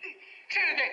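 choked with tears; voice breaking. Real, not acted. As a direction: let the voice crack. Preacher's voice breaking. (φωνή που σπάει από συγκίνηση)

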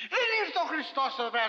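usual speaking voice

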